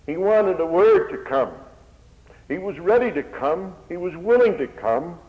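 high, loud building sermon; fervent preaching. Acted. Preacher builds to climax before hearers, high and loud. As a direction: drive it home to the congregation.

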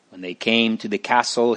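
ordinary delivery, nothing marked

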